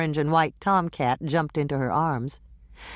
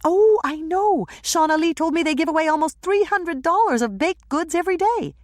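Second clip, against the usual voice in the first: excited; 13 semitones higher, 4 dB louder than usual. Acted. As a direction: gush about it. Andrea excited about contests.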